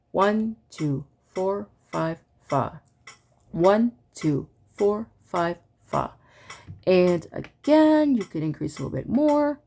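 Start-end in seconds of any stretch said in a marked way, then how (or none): 0.1-6.1 evenly spaced counting ending on fa; reverse recitation. Shown as a demonstration. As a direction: count it back in strict time, one word per click. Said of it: "And the inverse".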